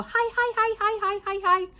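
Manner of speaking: loud, high, rapid-fire string of "hi"; echolalic repeat. Shown as a demonstration. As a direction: repeat "hi" over and over on a high pitch. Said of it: "I go".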